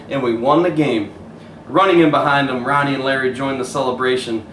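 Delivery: put-on gentle storybook narration for kids; story reading. A narrator's reading. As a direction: read it like bedtime story. Reads picture book aloud.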